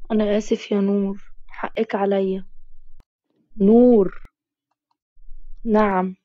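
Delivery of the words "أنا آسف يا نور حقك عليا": ordinary delivery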